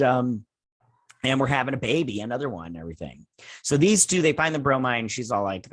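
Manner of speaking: ordinary talk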